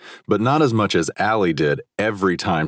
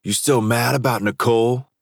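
taunting rival; 6 semitones higher than usual. A narrator's reading. Rival taunting after tackle.

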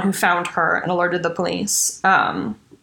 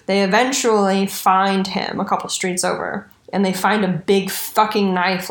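ordinary talk

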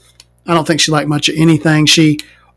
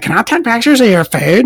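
higher, sing-song request; mock pushy lady; 7 semitones higher than usual. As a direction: imitate the pushy photographer. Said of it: "she's like".

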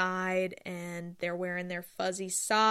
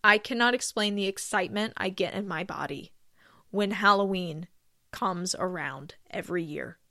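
normal talking voice

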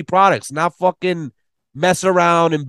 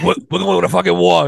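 lightly voiced comedian impression; 2 semitones higher than usual. Comedian as manager rallying [name], light impression. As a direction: play-act the comedian rallying for battle. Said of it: "[name] to fucking play".

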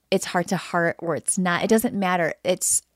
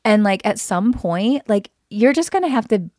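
louder, exasperated outburst; fed up; 2 semitones higher, 7 dB louder than usual. Real, not acted. Partner venting to him on the podcast, fed up and loud. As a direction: let the frustration boil over, loud. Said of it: "I'm done with like".

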